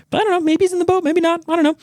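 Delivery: very high, hurried dithering; mock frantic doubt. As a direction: babble uncertainly in a high comic voice. Sam's doubt in same high voice.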